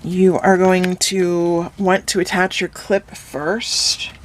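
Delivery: normal talking voice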